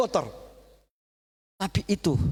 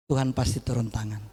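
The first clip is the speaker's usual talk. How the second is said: ordinary delivery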